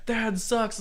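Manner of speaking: mock the player like the booing crowd; imitating a heckling crowd; booing fans. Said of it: "Everyone's booing".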